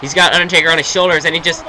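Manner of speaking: wrestling commentator calling the match; steady ringside play-by-play; play-by-play commentary. Acted. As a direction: call the action like a TV announcer.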